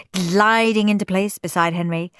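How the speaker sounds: usual speaking voice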